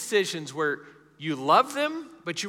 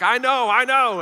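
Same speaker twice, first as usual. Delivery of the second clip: high, loud mock protest; retold biker; 9 semitones higher, 9 dB louder than usual. Biker answers the gecko in a commercial, loud mock insistence. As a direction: imitate a biker insisting loudly, pitched up. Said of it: "he's talking to that biker".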